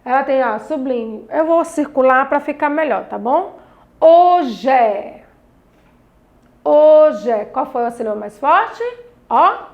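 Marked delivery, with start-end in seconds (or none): 4.0-5.2 "Hoje" said loud, leaning on the first syllable; stress on strong syllable. Shown as a demonstration. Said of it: "Fale em voz alta essas palavras". 6.6-7.5 "Hoje" called out loud, HO strongest; stress on strong syllable. Shown as a demonstration. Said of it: "Fale em voz alta essas palavras".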